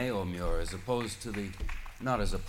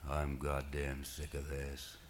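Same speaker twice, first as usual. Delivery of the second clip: a lightly voiced rumbly old man, slow and softer; thundery rumbly voice; 5 semitones lower, 5 dB quieter, slower than usual. A narrator's reading. Old man of the sea rises fed up, slow rumbling grumble. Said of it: "a thundery, rumbly voice remarked".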